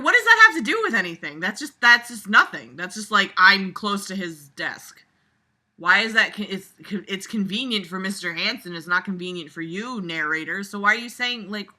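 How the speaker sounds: usual speaking voice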